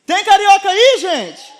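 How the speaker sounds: rallying call to the congregation. Real, not acted. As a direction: shout it out for a response. Calls the congregation again, louder response wanted.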